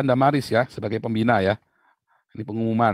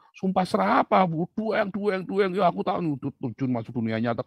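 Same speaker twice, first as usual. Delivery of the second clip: ordinary talk, nothing marked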